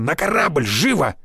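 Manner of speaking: brisk, urgent order; urgent command. A narrator's reading. Fighter ordering a run to the departing ship, urgent command.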